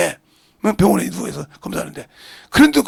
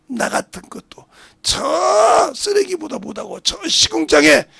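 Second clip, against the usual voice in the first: tearful prayer; 9 semitones higher, 4 dB louder, slower than usual. Acted.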